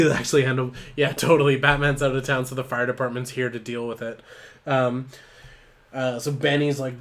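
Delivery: ordinary talk, nothing marked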